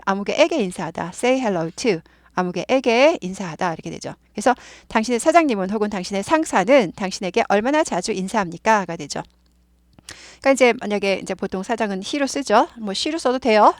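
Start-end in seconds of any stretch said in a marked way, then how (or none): none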